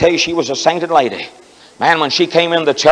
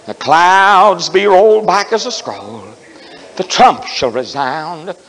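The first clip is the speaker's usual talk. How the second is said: solemn hymn recitation; slower than usual. Acted. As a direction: recite it slowly, then settle down.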